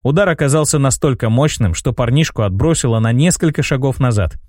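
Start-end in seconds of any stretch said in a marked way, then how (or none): none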